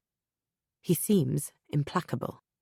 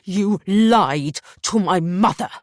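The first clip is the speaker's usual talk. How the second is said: loud, slow, higher accusation; furious; 4 semitones higher, 9 dB louder, slower than usual. Acted. Husband confronting wife, slow furious shout. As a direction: hammer each word home.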